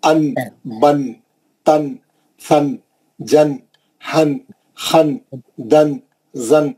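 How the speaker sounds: each tanween syllable drawn out slowly; teacher modelling letters slowly; slow drill recitation. Shown as a demonstration. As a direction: chant ان، بن، تن slowly with nasal n endings. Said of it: "حرف کو پڑھنا".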